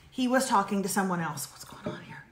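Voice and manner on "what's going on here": whisper. Real, not acted. Aside during the advice.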